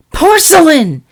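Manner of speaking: loud reproachful call of a big sister; admonishing. A narrator's reading. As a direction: snap her sister's name to stop her. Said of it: "admonished Tea Tray".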